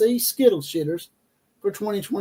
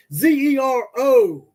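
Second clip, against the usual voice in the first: "Z E R O" spelled slowly, each letter punched higher; spelled out in caps; 5 semitones higher, 4 dB louder, slower than usual. Shown as a demonstration. Host spelling out a player's stats, slow emphatic spelling. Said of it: "All caps".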